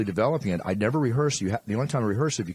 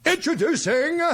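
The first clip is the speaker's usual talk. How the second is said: announcer call; 4 dB louder than usual. Shown as a demonstration. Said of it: "you say what I tell you".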